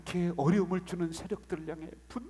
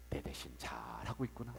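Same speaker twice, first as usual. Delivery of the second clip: whispered, much lower, sly; whispered mock quote; 6 dB quieter than usual. Preacher voicing a mistaken God who approves the abuse, whisper. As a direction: breathe the false idea of God.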